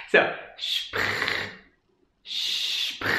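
spr hissed without voice, sh then p then r; spr sound. Shown as a demonstration. Teacher breaking spr into its sounds. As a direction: build spr slowly from sh, p, r. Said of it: "first like an S H".

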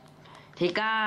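transformation cry. Pretend. Reviewer playing with the toy, mock hero transformation cry. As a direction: shout ทิก้า as if transforming into the hero.

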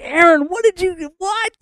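very high, sputtering disbelief; incredulous confrontation. Acted. Moses to Aaron about the statue, acted incredulous confrontation. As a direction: act out an aghast demand for explanation.

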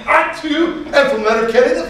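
high, amazed Irish lilt; hammed-up excited Irish brogue; Irish accent. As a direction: play an Irishman thrilled at the coincidence. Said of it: "a little bit of an Irish accent".

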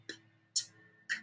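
"P, T, K" as voiceless puffs; non-vocalized consonants. Shown as a demonstration. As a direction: sound out P, T, K with air only, no voice. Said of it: "and non-vocalized".

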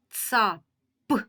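Ца, a pause, then a popped П; Ца-п with the П released on its own; separated final П. Shown as a demonstration. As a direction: split Ца-п before its last sound. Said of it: "В кінці цього слова у нас звук П".

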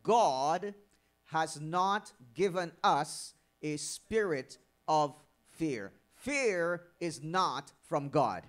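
slow, weighty stress on God and fear; emphatic slow preaching. Real, not acted. Preacher driving home his point, slow and weighty. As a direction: lean hard on each word, slowly.

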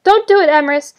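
high, loud desperate cry. A narrator's reading. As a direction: cry out a warning while held captive. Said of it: "she cried out".

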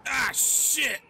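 surprised outburst. Real, not acted. Sudden gameplay outburst.

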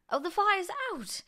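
put-on high, loud proud boy; proud announcement. A narrator's reading. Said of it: "George announced proudly".

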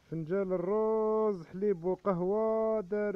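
loud, drawn-out slow sales cry; market hawker's call. Acted. Vendor crying out his pink cups to passing shoppers, loud and slow. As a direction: stretch the words out to carry across the market.

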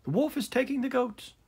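high, loud alarm call; crying out. A narrator's reading. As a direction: shout for help across the meadow. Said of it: "the boy's cry came again".

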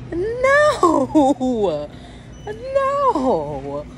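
high, stretched-out whiny no; wailing protest. Real, not acted. Refusing to be forced into marriage, wailing. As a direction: wail each refusal like a tantrum.

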